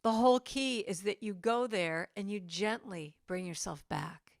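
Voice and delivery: ordinary delivery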